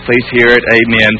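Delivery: low monotone recital, without feeling; going through the motions, low and toneless; mechanical rote prayer. Acted. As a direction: drone it out like a routine formality. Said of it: "it just became mechanical".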